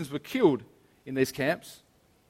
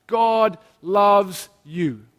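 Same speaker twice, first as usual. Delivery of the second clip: slow, loud, drawn-out proclamation; cross screams out; 3 semitones higher, 6 dB louder, much slower than usual. Acted. The cross crying out to everyone, loud and slow. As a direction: proclaim it slowly and fully. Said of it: "The cross screams out to us".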